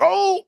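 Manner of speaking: a sudden high pained yelp; shot dad's cry. Acted. Dad gets shot.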